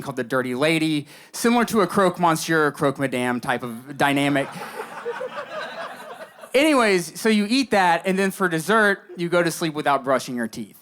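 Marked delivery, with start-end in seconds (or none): 4.5-6.5 big laugh from the crowd; crowd laughter. Real, not acted.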